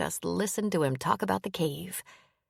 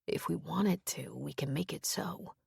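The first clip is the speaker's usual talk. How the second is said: soft reassurance; 8 dB quieter than usual. A narrator's reading. Comforting her at night.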